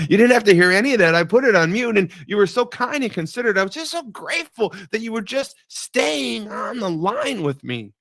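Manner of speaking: high, loud rush of thanks; fervent gratitude. Retold or imitated. Husband thanking his wife for staying on the line, fervent. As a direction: pour out thanks, pitched right up.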